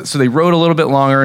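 usual speaking voice

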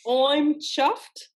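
put-on very slow, drawn-out British accent; loud, high, laboured British try; amateur British, word by word; attempted British accent. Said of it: "trying to do Cockney".

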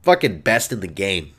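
usual speaking voice

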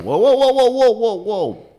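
high, loud string of halting cries; acted protest. Friend stopping the waiter taking her soup, loud protest.

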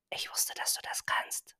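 soft tender whisper. Acted. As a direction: whisper it close to her child. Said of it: "flüsterte sie".

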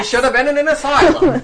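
loud, high mock townsperson; hammed-up public disapproval; judging townsfolk. Townsfolk judging the spirited woman, loud hammed-up scorn.

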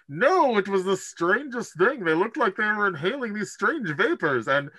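high put-on voice for Brewer, telling the tale; Brewer's voice. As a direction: Brewer explains his side down the hallway, high-pitched. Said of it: "It's like".

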